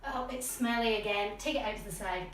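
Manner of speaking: ordinary delivery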